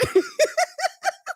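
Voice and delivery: extremely high, squeaky laugh; squealing laughter. Real, not acted. Laughing at chat's nickname.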